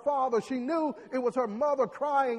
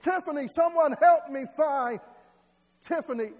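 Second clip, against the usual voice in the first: louder, higher plea for help; screaming for help; 5 semitones higher, 6 dB louder than usual. Acted. Parents beg the crowd to find Tiffany, louder pleading call. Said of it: "they screamed to the top of their lungs".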